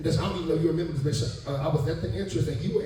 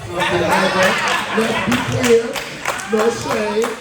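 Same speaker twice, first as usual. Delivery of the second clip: drawn out, slow and much louder, laughing; sassy drawn-out disclaimer; sassy emphasis; 6 semitones higher, 8 dB louder, much slower than usual. Real, not acted. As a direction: lean into it with a grin. Playful emphatic sass, laughing.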